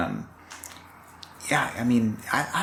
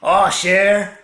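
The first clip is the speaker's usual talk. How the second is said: hammed-up Cajun accent, high and loud; Gambit; 9 semitones higher, 7 dB louder, much slower than usual. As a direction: belt it out in a mock Louisiana Cajun drawl, slowing at the end. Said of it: "that X-Men character that's Cajun, Gambit".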